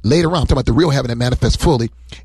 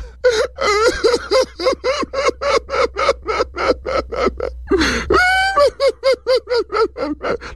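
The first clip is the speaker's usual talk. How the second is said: high, hammed-up wail; exaggerated comic crying; mock wailing cry. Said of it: "started crying".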